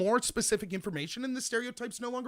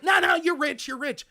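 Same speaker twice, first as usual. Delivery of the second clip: insistent denial; 6 semitones higher, 8 dB louder than usual. Pretend. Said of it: "you're just like".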